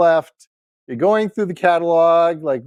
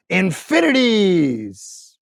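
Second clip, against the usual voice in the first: long drawn-out word, imitating a little boy; slow, gleeful child's exaggeration; imitated excited kid; 2 semitones higher, much slower than usual. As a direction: stretch it out like a kid boasting of endless love. Voicing his small son's huge answer.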